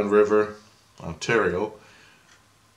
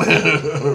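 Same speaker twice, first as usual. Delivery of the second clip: short, loud, high chuckle; amused laugh; 8 semitones higher, 8 dB louder than usual. Real, not acted. Teller amused by his buddy not believing, chuckling. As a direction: burst out chuckling.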